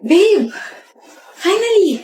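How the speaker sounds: calling across the room, thrilled; excited call. Acted. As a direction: call out to him, overjoyed. Calling out, delighted.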